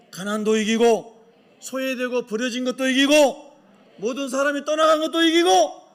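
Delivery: loud, rousing sermon climax; full-force preaching. Real, not acted. Preacher urges congregation to win over hardship, rousing proclamation. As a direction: drive each phrase home to the congregation.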